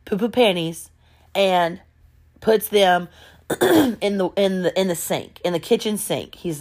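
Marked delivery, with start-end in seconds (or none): none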